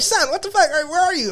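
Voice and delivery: very high-pitched imitation of a mother; alarmed mom. Mom answering son's prank call, imitated high-pitched alarm. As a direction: mimic a panicked mom, voice shot way up. Acting out mom's alarmed reply.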